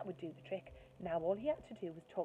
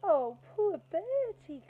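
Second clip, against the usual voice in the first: much higher, louder, slow cooing sympathy; cooing girl; 12 semitones higher, 11 dB louder, much slower than usual. Acted. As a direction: coo over him like a hurt puppy. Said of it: "cooed Angela".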